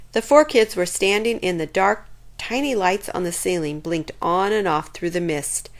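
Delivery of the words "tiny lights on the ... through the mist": usual speaking voice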